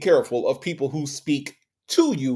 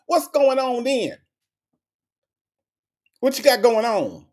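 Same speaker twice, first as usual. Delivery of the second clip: higher-pitched, hammed-up nosy questioning; mock pestering; 6 semitones higher than usual. Exaggerating an annoying man grilling his wife, mock pestering. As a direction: mimic a relentless pest demanding details.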